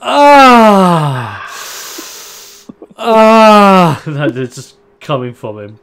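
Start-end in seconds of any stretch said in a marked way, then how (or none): 0.0-4.0 loud high cries of pain, drawn out. Acted. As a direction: wail in pain twice. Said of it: "you just hear".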